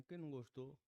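normal talking voice

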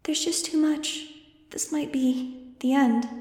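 put-on high, slow, hushed child's voice; a child giving up hope, speaking faintly; despairing little girl. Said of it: "Angel's fuzzy, troubled thought".

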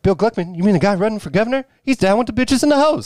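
high, fast, excited mock townsfolk. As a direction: squeal it like a starstruck local. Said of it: "They're like".

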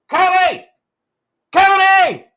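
high, loud mock chant of Cody; imitating kid's shout. Imitating a kid in the crowd yelling at Cody, high and loud. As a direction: yell the name like a kid in the crowd. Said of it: "You could just hear".

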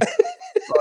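high, wheezy burst of laughter; real laughter. Real, not acted. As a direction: keep cracking up. Still laughing, apologising.

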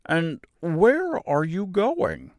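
high, very slow question; high-voiced, wondering straw man; curious scarecrow. A narrator's reading. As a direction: draw out each word curiously.

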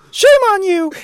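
Dorothy scolding the lion in a shrill-high put-on voice; mock Dorothy scold. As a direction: squeal the scolding like a shocked young girl. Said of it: "Dorothy turns around and slaps me".

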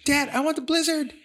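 put-on high whining child's voice; whiny kid. The older son begging dad for the Blizzard, high whiny kid voice. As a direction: beg like a small boy. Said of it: "we'll go like".

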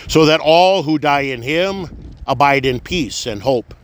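ordinary delivery

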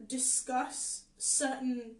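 ordinary delivery

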